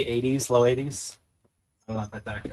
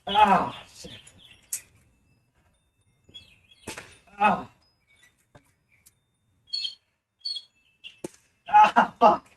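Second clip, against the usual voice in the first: in pain; 7 semitones higher, much slower than usual. Real, not acted. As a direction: cry out from a stinging hit. Shot in the head, groaning.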